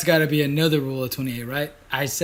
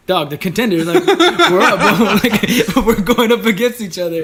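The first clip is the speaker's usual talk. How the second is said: high, loud and laughing, pumped about the fight; boasting to your buddy that you're both contenders; hyped-up retelling; 6 semitones higher, 10 dB louder, slower than usual. Retold or imitated. Retelling to his cohost how hyped they were, loud and laughing.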